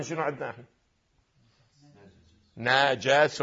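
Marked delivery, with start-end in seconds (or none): 2.6-3.4 "نجس" as na-ja-sun, low and soft; fatha on both letters. Shown as a demonstration. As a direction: sound "نجس" with a after both n and j. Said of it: "يعني فتح النون وفتح الجيم". («نجس» بفتح النون وفتح الجيم)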